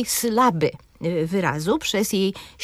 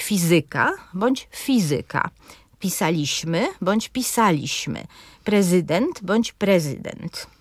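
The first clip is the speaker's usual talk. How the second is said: „fizyka”, „pisaliśmy”, „prezydent”: each word said twice, stress moved to a different syllable each time; różny akcent wyrazowy. Shown as a demonstration. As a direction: demonstrate how each word sounds with the stress placed two different ways. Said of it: "postaram się teraz wymówić kilka wyrazów, różnie je akcentując". (pokaz akcentu: „fizyka” raz z akcentem na pierwszej, raz na drugiej sylabie, podobnie „pisaliśmy” i „prezydent”)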